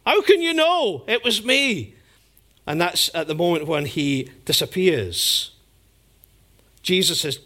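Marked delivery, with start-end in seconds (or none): 0.0-2.0 Judas erupting. Retold or imitated. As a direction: erupt at him, caught and defensive. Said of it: "Judas just kind of erupts".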